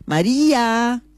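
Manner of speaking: high, drawn-out call of María; calling down the hall. Acted. Husband yells down the hallway for his wife, calling out. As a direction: yell her name down the corridor. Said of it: "gritale por el pasillo".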